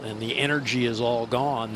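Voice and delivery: ordinary talk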